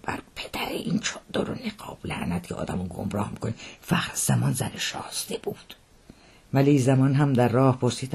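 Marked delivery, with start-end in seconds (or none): 0.0-5.7 low, breathy grumble under the breath; hushed, rueful muttering; muttered curse. Acted. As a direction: curse softly to a companion. Curses once the women have left.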